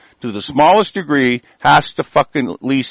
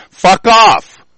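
angry outburst; 4 semitones higher, 6 dB louder than usual. Real, not acted. Angry rant at authorities.

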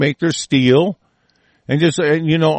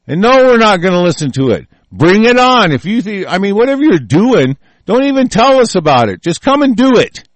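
loud, higher, building dare; defiant shouting; 7 semitones higher, 5 dB louder than usual. Real, not acted. Ranter daring China to attack, loud rising shout. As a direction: yell the challenge higher and higher.

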